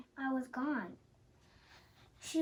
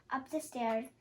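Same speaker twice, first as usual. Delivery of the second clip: plain reading voice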